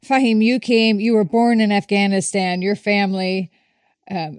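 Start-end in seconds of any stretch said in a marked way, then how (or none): none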